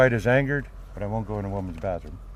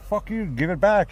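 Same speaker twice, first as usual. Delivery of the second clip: mock angry demand; 9 semitones higher than usual.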